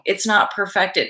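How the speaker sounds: normal talking voice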